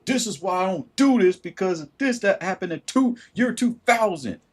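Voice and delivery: high, mock-resentful complaint; bitter excuse. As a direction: whine it up high about the past. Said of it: "five years ago and say".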